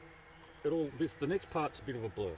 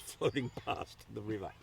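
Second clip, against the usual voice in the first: ordinary delivery, nothing marked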